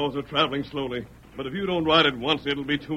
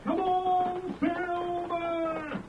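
high, ringing call to the horse; 13 semitones higher, 6 dB quieter, much slower than usual. Acted. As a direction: shout it out across the plain. Rider calling to his horse.

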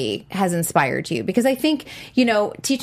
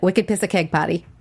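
"Wicked piss of keg party" with dropped R, Boston style; Boston accent. Shown as a demonstration. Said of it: "Can you say that?".

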